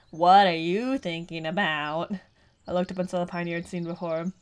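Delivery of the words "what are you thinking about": robotic voice. Acted. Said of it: "his voice".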